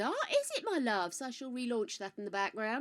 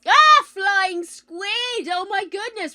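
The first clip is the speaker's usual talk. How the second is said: high, loud, slow hail; loud drawn welcome; 8 semitones higher, 13 dB louder, slower than usual. Pretend. Streamer hails a viewer with a loud drawn-out call. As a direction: call the name out long and loud.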